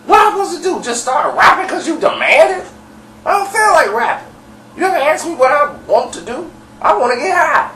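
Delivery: high-pitched mock outburst; snapping. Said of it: "so he snapped on her".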